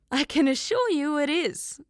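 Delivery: high, loud sarcastic retort; mock the idea, with a laugh behind it; a touch of sarcastic laughter. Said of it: "Nell laughed sarcastically".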